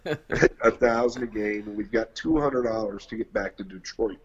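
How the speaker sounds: ordinary talk, nothing marked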